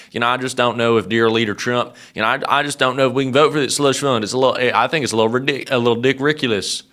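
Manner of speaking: lightly put-on Southern accent, quick; a hint of a Southern senator's accent, rushed; mock Alabama drawl. Host mocking Tuberville's Southern accent on air. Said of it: "I can use the accent 'cause this is how he sounds".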